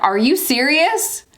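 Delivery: high, drawn-out disbelief; incredulous. Retold or imitated. Woman answering officer's untimely question, incredulous and high.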